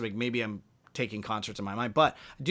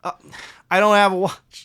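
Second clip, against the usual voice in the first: louder and higher, retelling the guy's answer; retold loud reply; 10 semitones higher, 13 dB louder than usual. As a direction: call it back across the street. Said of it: "And he goes".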